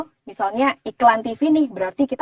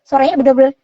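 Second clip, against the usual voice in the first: ordinary talk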